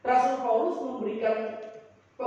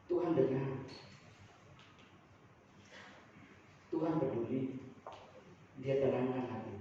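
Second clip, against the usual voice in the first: soft reassurance; 8 semitones lower, 10 dB quieter than usual. Acted. Preacher comforts congregation.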